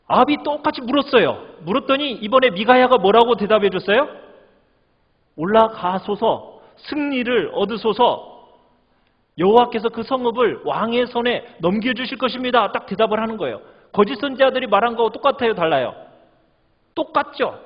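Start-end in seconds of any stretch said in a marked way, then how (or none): 5.4-12.7 mock-sarcastic answer. Prophet answers the king's war question, sarcastic. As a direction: exaggerate the solemn promise with sarcasm. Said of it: "아주 빈정대는 투로 대답을".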